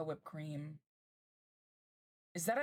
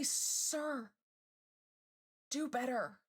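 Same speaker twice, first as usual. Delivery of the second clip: mock scolding; 6 semitones higher, slower than usual.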